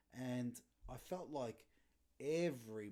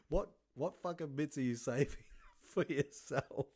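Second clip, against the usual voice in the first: shocked retort; 4 semitones higher, 7 dB louder than usual. Retold or imitated. Son shocked at dad.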